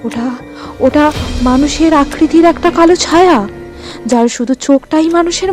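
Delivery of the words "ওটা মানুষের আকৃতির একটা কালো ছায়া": usual speaking voice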